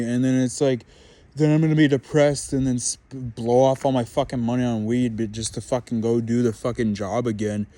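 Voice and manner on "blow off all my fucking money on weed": ordinary talk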